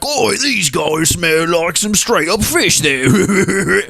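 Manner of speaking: high, slow gossiping dwarf. Acted. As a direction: sneer it slowly through a laugh. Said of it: "a faint sound of the dwarf".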